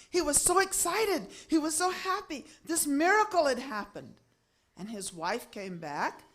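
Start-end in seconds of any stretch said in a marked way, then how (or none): 0.1-4.2 high, elated storyteller delivery; excited narration. A narrator's reading. Storyteller announcing a miracle cure to listeners, excited. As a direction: tell it bursting with joy.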